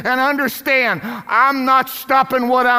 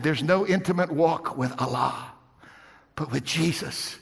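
much softer and lower, nearly whispered; hushed confession; 9 semitones lower, 11 dB quieter than usual. Retold or imitated. Preacher voicing banker's faith, soft and hushed. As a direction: tell it softly like a secret. Sherry's tender confession retold.

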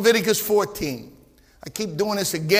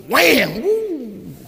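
louder, higher whip crack and whoop; excited whoop; 6 semitones higher, 4 dB louder than usual. Acted. Preacher acting out Jesus with a whip, a high excited whoop.

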